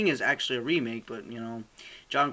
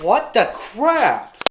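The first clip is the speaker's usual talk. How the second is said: high, loud, slow shocked cry; 9 semitones higher, 9 dB louder, much slower than usual. Real, not acted. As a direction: stretch it out in shock. Higher shocked repeat.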